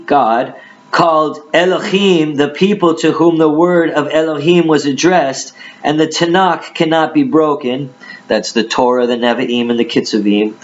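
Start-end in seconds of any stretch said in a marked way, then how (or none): 0.9-7.9 raised, emphatic Bible reading voice; dramatic scripture reading. Acted. Preacher reads Jesus answering the Judeans, dramatic scripture reading. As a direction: declaim the verses at a higher pitch.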